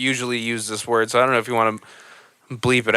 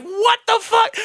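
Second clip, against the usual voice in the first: much higher shouted disbelief; shocked outburst. Retold or imitated. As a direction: blurt it out in shock. Shocked reaction to movie.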